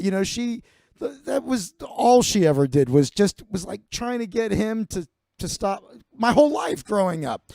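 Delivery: usual speaking voice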